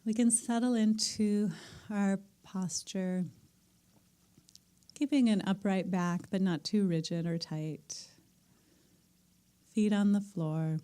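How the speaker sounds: calm meditation teacher settling the room; guided meditation. Acted. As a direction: ease the group gently into stillness. Leads the group into meditation.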